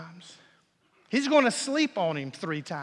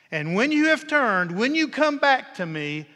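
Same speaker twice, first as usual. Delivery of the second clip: fervent emphasis; 5 semitones higher, 9 dB louder than usual. Acted. Jesus's promise quoted with emphasis.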